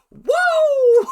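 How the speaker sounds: high, loud, drawn-out whoa; mock astonished whoa. Reacting to sudden duet news, astonished. Mock shock at random dancing.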